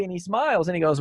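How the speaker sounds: ordinary talk, nothing marked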